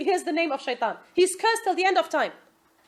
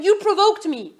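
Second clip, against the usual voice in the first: louder mock blaming husband; husband's excuse; 5 semitones higher, 5 dB louder than usual. Preacher imitating a husband blaming his wife, louder mock excuse. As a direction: throw the blame like a sulky husband. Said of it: "when the husband says something".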